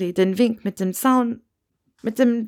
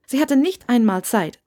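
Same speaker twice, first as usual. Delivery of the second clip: ordinary delivery, nothing marked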